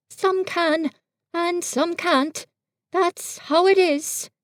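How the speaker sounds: Pooh, innocent and fumbling; Pooh bear voice. A narrator's reading. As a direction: play a guileless little bear. Pooh thinking aloud.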